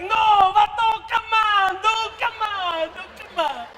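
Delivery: put-on high, pleading Mexican accent; Mexican camouflage. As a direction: plead your way out as a Mexican, fading. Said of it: "I can camouflage at any moment".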